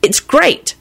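high, happy enthusiasm. A narrator's reading. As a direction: gush happily to a friend. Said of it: "he finished happily".

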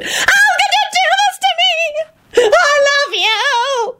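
very high slow sobbing wail. A narrator's reading. Loretta to Quentin, very high sobbing wail. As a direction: wail and collapse in tears. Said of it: "Loretta wailed".

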